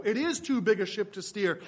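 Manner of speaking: ordinary delivery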